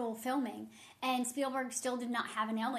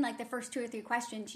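ordinary talk, nothing marked

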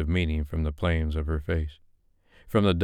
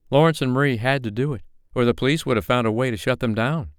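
higher, irritated diva voice; irritated dame; 6 semitones higher than usual. A narrator's reading. Madam M complains to Clark, higher irritated dame. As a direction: wave it away with irritation.

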